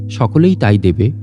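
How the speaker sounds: usual speaking voice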